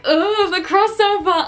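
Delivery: high, slowed delight; light up at a familiar face; delighted recognition. Retold or imitated. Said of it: "and I was like".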